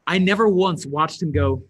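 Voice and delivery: usual speaking voice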